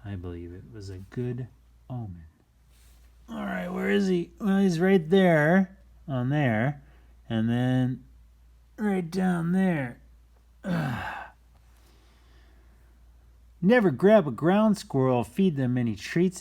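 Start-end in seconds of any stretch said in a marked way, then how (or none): 3.3-10.0 high loud finding voice; excited. Real, not acted. Spotting bug in picture. 13.6-16.4 excited. Acted. Warning rhyme read loud.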